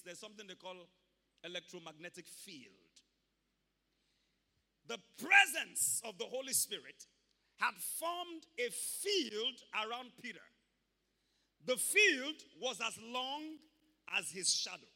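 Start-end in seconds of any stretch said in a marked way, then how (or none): 4.9-14.9 loud, slow, emphatic sermon voice; preaching at full force. Real, not acted. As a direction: build it up like a preacher at the pulpit.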